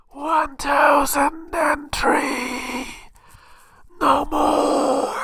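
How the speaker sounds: high, slow, whispered alien voice; eerie alien oracle voice; alien from Independence Day. Acted. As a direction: proclaim it like an imprisoned alien. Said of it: "reading this in the voice of the alien in Independence Day".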